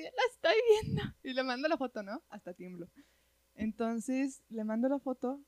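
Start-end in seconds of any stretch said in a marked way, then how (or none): 0.0-1.0 high, fast, tearful insistence; sobbing, insisting to her about the photo; retold crying insistence. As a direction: blurt it out through tears. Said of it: "Yo así".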